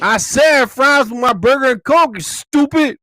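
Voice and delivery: high, loud furious yell; yelling at the drive-thru worker who got the order wrong; angry shout. Retold or imitated. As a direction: blow up at the clerk.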